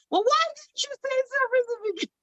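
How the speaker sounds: high, fast, mock-offended retort; hammed-up huffy comeback; mock indignation. Answering her mother who only now admits the brownies are better, mock indignation. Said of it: "And I was like".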